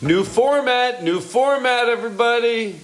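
high, hyped-up announcement; excited hype. Acted. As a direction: pump up the crowd. Hyping the new format.

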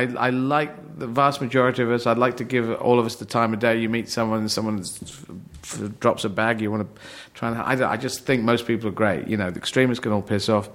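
normal talking voice

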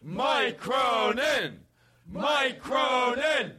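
high, slow drawn-out shouted intro; announcer shout. Acted. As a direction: call the name out like a ring announcer. Repeated announcer-style guest intro.

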